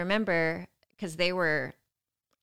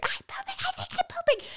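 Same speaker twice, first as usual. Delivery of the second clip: very high, softened squeal; mock scream. Woman in labour screaming in alarm, mock scream. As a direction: squeak out a held-back imitation scream. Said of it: "I am screaming".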